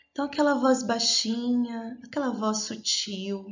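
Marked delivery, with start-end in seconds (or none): none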